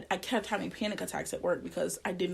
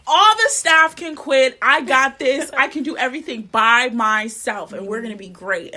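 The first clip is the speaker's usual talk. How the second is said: overeager boast; 4 semitones higher, 12 dB louder than usual. Retold or imitated. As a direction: shout it like the new hire who can do it all. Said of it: "so I'll be like".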